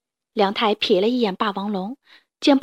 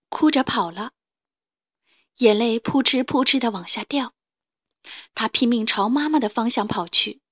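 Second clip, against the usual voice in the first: ordinary delivery, nothing marked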